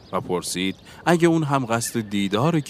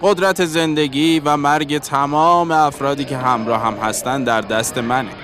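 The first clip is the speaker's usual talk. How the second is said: loud, higher-pitched proclamation; commanding caravan leader; 6 semitones higher, 5 dB louder than usual. A narrator's reading. Bearded guide warns the travellers, loud commanding address.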